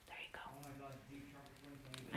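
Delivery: slow, hushed whisper. Real, not acted. Creeping up on the sleeping puppy, whispering very slowly. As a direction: barely breathe it so the puppy sleeps.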